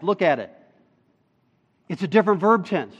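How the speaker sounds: normal talking voice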